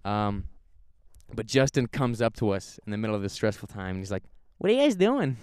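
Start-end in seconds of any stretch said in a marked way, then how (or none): 4.6-5.4 high, loud mock kid; imitating a twelve-year-old butting in; bratty kid voice. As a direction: pitch it up like a nosy kid.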